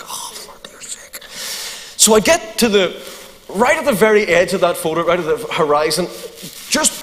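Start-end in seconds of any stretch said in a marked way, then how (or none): 0.0-1.2 breathless whispered despair; whispered exasperation. Retold or imitated. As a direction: whisper it under your breath, fed up. Exasperated aside while chased.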